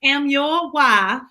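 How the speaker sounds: very slow, drawn-out Southern drawl; Southern accent. Shown as a demonstration. Wife practicing a slow Southern accent on husband. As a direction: lay on a not-quite-there Southern accent. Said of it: "trying to learn my Southern accent".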